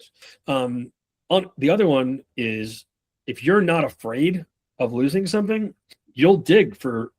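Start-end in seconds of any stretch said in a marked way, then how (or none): none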